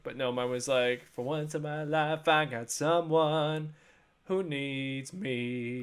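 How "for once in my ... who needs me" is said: slow, drawn-out dramatic line; mock-heartfelt movie line; dramatic quote. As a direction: lay it on like a movie moment. Quoting a song/movie line dramatically.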